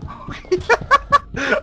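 high laugh after a fright; nervous laugh. Real, not acted. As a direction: laugh off the scare. Nervous after scare on stairs.

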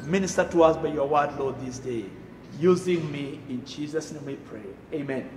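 usual speaking voice

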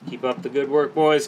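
usual speaking voice

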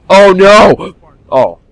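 loud, high frightened "oh no"; scared yelp. Real, not acted. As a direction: jump in real fright. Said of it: "I got a little scared".

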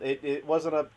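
ordinary talk

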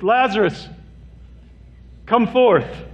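high call calling the dead man out of the tomb; loud cry. A narrator's reading. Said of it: "he cried out in a loud voice".

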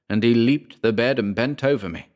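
ordinary delivery, nothing marked

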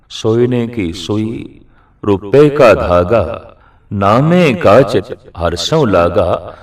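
slow, measured scripture recitation; verse recitation. Acted. Preacher quotes Namdev's verse to the congregation, slow recitation. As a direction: draw out each phrase of the sacred line.

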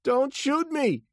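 very high, loud, slow plea; panicked, high-pitched yell; frightened cry. A narrator's reading. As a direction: beg for your life. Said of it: "he cried".

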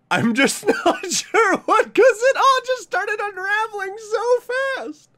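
very high, drawn-out whine; bewildered falsetto wail; overwhelmed squeal. Real, not acted. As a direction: squeal it out slowly, totally overwhelmed. Recalls overwhelming ending, squeals.

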